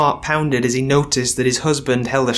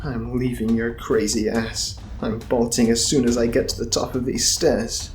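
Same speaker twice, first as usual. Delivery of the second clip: softer determined thought; decide it silently; inner resolve; 3 semitones lower, 5 dB quieter than usual. Acted. Bum's resolve inwardly.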